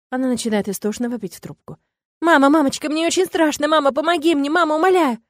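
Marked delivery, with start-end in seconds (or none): 2.2-5.2 put-on high, loud crying child; terrified child sobbing. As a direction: scream for mama in terror. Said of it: "Рыдает она".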